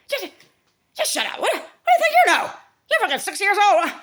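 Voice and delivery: high, loud, scornful parent voice; scolding parent. Retold or imitated. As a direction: snap down at the child like an angry parent. Parent scolding child in story.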